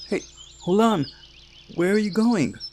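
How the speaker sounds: high, loud call to stop them; shouting after them. Acted. Camp leader shouting after the fleeing pair. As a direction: yell for them to come back.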